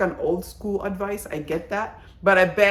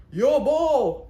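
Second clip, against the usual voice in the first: imitating a drunk man; 7 semitones higher, 3 dB louder than usual. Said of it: "he got drunk and he's like".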